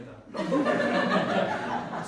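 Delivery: big unvoiced laugh at his own story; loud burst of laughter. Real, not acted. Father cracking up at his toddler's grammar, loud laughter.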